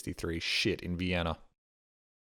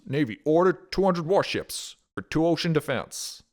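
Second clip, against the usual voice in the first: put-on nasal old-time newsreel announcer, a bit louder; vintage newsreel headline voice; 1940s news voice; 4 semitones higher, 5 dB louder than usual. Shown as a demonstration. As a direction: read it like a wartime radio bulletin. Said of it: "in a nasally nineteen forties news voice".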